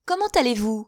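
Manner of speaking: high, eager, fluttering spinster voice; chattering Miss Bates. A narrator's reading. Miss Bates gushing to her guests, high and eager.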